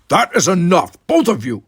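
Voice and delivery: a commanding shout; stern snap. Acted. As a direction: slam the argument shut. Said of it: "snapped Worf".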